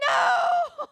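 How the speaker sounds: sudden high yelled no; real shout. Real, not acted. Watching the game, yelling at a blown play. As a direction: shout at the screen in dismay. Reacting to a missed shot live.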